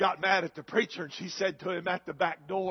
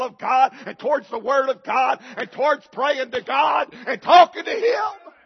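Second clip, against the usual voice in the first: building louder into a crying shout; preaching to a crying shout; 6 semitones higher, 10 dB louder than usual. Real, not acted. Pastor lamenting his town's unfaithful, shouting and crying. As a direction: pour it out until the voice cracks.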